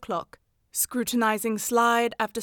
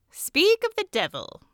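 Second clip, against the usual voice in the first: much higher, bright exclamation; exclaiming; 11 semitones higher, 4 dB louder than usual. A narrator's reading. Holmes sees Mrs Hudson arrive with mail, lively high exclamation. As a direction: toss it off as the landlady walks in. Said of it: "exclaimed Holmes".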